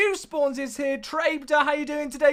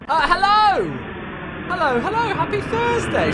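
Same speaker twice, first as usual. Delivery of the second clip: high, loud stream opener; loud cheerful welcome to chat; big excited greeting; 8 semitones higher, 5 dB louder than usual. Real, not acted. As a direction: burst in with a big hello. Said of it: "Sorry, that was a bit of a deflation".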